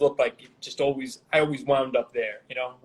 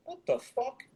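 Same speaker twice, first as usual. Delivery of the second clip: higher, softer incredulous quote; mock disbelief; 10 semitones higher, 6 dB quieter than usual. Retelling how puzzled musicians reacted backstage, mock disbelief. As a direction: imitate baffled onlookers in a raised pitch.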